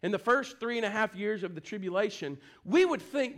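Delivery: normal talking voice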